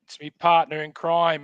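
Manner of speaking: normal talking voice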